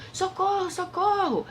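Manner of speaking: grito agudo de socorro; pajem gritando. A narrator's reading. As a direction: grite socorro bem agudo. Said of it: "O pajem novamente gritou". (pajem pedindo socorro aflito)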